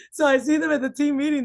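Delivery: surprised what then a laugh; amused disbelief. Real, not acted. Manager hearing odd clap sound, laughing.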